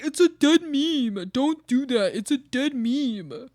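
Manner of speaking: put-on teen coworker scoffing. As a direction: declare the meme dead. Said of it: "they'll be like".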